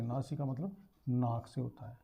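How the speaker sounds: ordinary delivery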